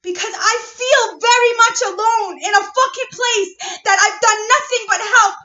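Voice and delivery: real furious yell; angry shouting. Real, not acted. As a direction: roar it like you're finally done holding back. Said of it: "I'm angry".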